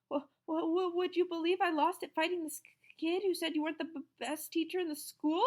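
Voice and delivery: high, nervous stammer. A narrator's reading. Scared teacher stammering an excuse, high. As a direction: stumble over every start. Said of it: "he stammered".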